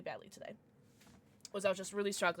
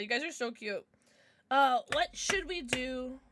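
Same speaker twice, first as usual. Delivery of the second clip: ordinary talk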